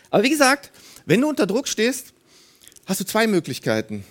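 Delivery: ordinary talk, nothing marked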